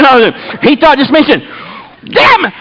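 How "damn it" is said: high, hollered punchline; exasperated outburst. Retold or imitated. Man giving in about communion, exasperated outburst. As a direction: imitate fed-up, frantic resolve.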